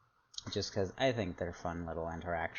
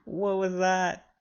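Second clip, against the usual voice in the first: high, loud and slow, caught off guard; startled; 12 semitones higher, 9 dB louder, slower than usual. Real, not acted. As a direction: blurt it out in shock. Startled by sudden text-to-speech boo.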